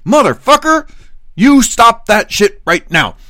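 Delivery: loud, high, emphatic shout; angry outburst. Acted. Translating what "sir" really means, furious shouted order. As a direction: punch out each word at full volume. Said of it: "You mean".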